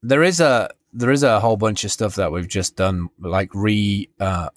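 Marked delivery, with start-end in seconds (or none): none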